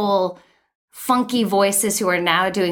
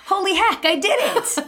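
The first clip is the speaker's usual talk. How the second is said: high-pitched delighted disbelief; amazed triumph; 11 semitones higher than usual. Retold or imitated. Actor reacting to booking a Gatorade spot, amazed triumph. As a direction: exclaim it high, thrilled at your own win.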